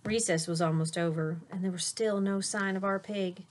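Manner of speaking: lively storybook read-aloud; reading to children. A narrator's reading. Teacher reading a picture book to her class, animated. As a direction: read it bright and animated for little listeners.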